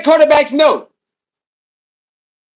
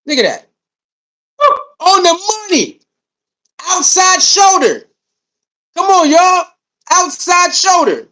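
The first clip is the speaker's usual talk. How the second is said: excited calling out; 6 semitones higher than usual. Real, not acted. Calling out the throw on replay to viewers, slow excited shout. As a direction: stretch each word out as you cheer the throw.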